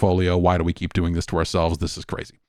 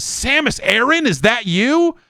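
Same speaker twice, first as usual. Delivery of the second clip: high, sing-song mock greeting with rising ends; mocking the engineer; 14 semitones higher than usual. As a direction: parody the engineer's awkward hello. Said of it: "and he says".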